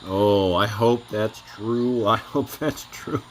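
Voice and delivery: usual speaking voice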